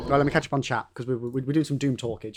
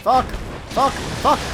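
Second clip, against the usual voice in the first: frustrated cursing; 9 semitones higher, much slower than usual. Real, not acted. Boss fight frustration.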